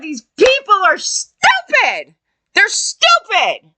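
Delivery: high, drawn-out exasperation; fed-up wail at their stupidity; exasperated. Retold or imitated. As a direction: stretch it out in high-pitched disbelief. Exasperated rant about stupid people.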